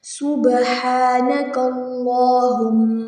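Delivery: slow, measured, chanted recitation of the Sana; full Sana read out as prayer. Acted. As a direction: recite the whole prayer in a devout, steady flow.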